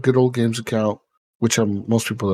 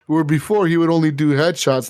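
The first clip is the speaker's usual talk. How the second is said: ordinary delivery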